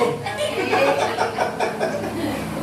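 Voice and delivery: unvoiced high chuckle; airy laugh. Real, not acted. Preacher laughing after mocking accusers, airy chuckle. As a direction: laugh breathily at the joke.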